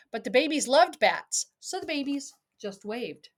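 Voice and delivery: lilting read-aloud storybook voice; sing-song picture book reading; reading to a child. Acted.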